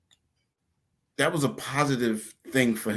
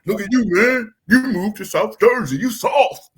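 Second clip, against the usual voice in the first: higher, louder taunting street voice; mocking tough guy; 10 semitones higher, 7 dB louder than usual. The cousin's boyfriend taunting the speaker, higher louder mocking. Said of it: "And then he like".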